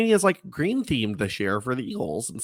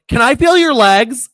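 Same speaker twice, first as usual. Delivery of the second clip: very high, loud imitated gush; retold eager request; 12 semitones higher, 12 dB louder than usual. Nydia asking to feel his legs, imitated loud high squeal. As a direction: imitate a thrilled, forward fan squeal. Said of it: "she's like".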